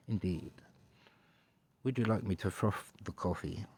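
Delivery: soft posh English butler; Grim. Pretend. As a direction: offer it softly in posh English. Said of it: "particularly posh English".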